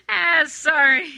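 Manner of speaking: slow, laughing apology; still laughing. Acted. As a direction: apologize through leftover giggles.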